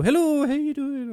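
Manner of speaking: high, slow sing-song hello; mock chirpy host; cheery greeting. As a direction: greet the listeners sweetly. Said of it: "you have one that go".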